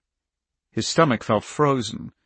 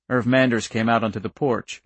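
plain reading voice

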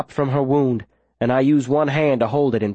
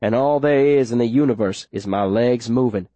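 ordinary delivery, nothing marked

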